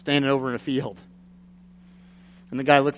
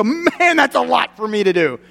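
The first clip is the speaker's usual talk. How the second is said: overwhelmed sigh; 8 semitones higher, 5 dB louder than usual. Retold or imitated. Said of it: "read this and go".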